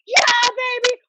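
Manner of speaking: high excited yell of triumph; retold cheer. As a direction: shout it like the winning moment. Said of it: "I was like".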